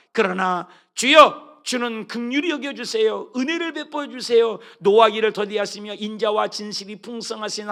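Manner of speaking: loud, impassioned psalm reading; fervent preaching. Acted. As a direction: cry the psalm out to God. Reads psalm as fervent prayer.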